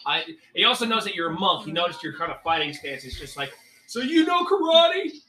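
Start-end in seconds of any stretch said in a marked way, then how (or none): 3.9-5.2 high, lightly Farley-tinged instructor voice. Acted. DM as instructor Grim challenging the ranger to stab him. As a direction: challenge the student to attack you.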